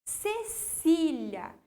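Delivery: "Cecília" drawn out slowly, leaning on "cí"; stress on middle syllable. Shown as a demonstration. Teacher showing pupils the strong syllable, "Cecília" slow and stressed on "cí". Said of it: "é a minha sílaba mais forte".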